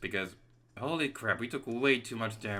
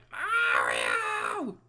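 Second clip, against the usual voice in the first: 1 high-pitched Luigi; 9 semitones higher, 4 dB louder than usual. Retold or imitated. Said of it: "Luigi gets all high-pitched".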